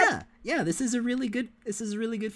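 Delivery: high, bubbly praise for the game; excited gush. Real, not acted. Streamer gushing to co-host about the game, excited.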